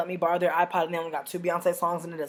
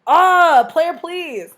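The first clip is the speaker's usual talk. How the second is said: high, loud, scornful telling-off, slowing at the end; higher loud scolding that slows right down; loud roast; 8 semitones higher, 8 dB louder than usual. Retold or imitated. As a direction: tell him off, and drag out the last words.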